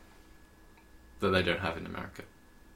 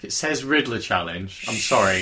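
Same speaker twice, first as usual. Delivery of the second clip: normal talking voice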